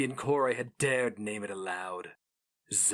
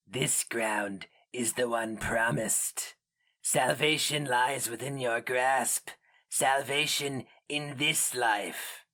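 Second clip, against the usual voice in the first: slow, rasping creature promising salvation; a hint of an inhuman voice; alien preacher; 4 semitones higher, slower than usual. A narrator's reading. The Inkoroi promises Turturga salvation, lightly rasped creature voice.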